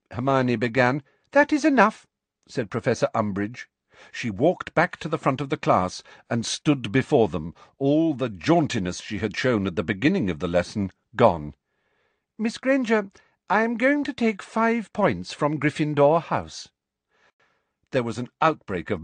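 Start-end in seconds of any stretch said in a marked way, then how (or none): 1.3-2.1 high, loud teacher's reprimand; sharp cut-off. A narrator's reading. Teacher interrupts arguing pupil, sharp cut-off. 12.4-16.7 high-pitched, prim reprimand; punishing teacher. A narrator's reading. Teacher docks house points in front of class, punishing teacher.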